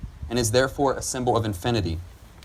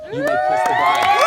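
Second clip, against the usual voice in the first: loud, high, jubilant call; joyful shout; 8 dB louder than usual. Real, not acted. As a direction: announce it with a whoop. Officiant's joyful final announcement.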